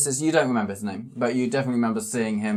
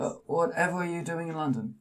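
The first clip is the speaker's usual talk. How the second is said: put-on small old man voice; 8 semitones higher, faster than usual. GM voices Charles Adams.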